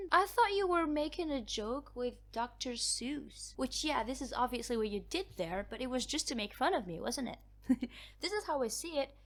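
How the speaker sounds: ordinary talk, nothing marked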